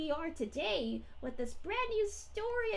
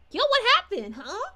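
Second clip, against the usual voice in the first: loud, higher-pitched puzzled outburst; mock bafflement; 5 semitones higher, 9 dB louder than usual. Streamer imitates baffled viewers when the mic is muted, mock bafflement. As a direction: blurt it out like a baffled onlooker.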